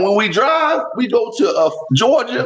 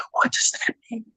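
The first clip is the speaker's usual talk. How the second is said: whisper; 4 semitones higher, 7 dB quieter than usual. Retold or imitated. Son in the back seat whispering to his father. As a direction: breathe it secretly. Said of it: "I don't know why he whispered it".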